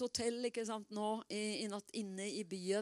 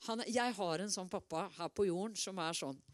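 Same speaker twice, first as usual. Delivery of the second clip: ordinary talk, nothing marked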